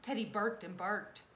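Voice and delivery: plain reading voice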